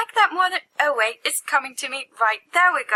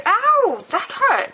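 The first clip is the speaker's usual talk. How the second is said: pained yelp; 10 semitones higher, 5 dB louder, much slower than usual. Acted. Hit by a friend.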